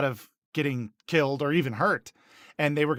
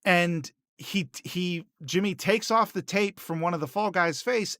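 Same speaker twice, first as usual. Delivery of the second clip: usual speaking voice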